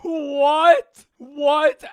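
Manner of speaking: high, very loud shocked "what"; disbelieving outburst. Real, not acted. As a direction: shout it in real disbelief. Reacts in disbelief to the story.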